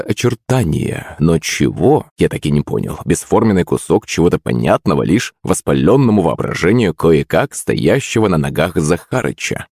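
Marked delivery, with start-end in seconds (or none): none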